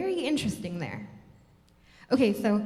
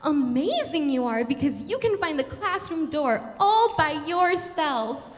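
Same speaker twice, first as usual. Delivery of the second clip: loud, higher, gushing over-praise; hammed-up wonder, slowing at the end; mock amazement; 4 semitones higher, 5 dB louder than usual. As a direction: imitate a patronizing teacher's exaggerated praise. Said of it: "they're constantly telling you how".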